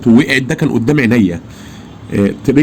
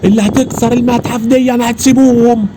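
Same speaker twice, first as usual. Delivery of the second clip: urgent pointing out; 12 semitones higher than usual. Retold or imitated. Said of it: "قلت له".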